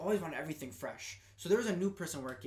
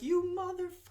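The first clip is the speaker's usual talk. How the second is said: high-pitched imitation of an irate customer; hammed-up customer tirade, pitched way up; mock cursing out; 13 semitones higher than usual. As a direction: squeal the insult without really yelling. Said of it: "she's cussing me out".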